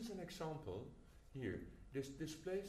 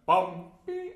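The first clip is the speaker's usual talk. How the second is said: forte again; 18 dB louder than usual. Shown as a demonstration. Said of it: "and you go again".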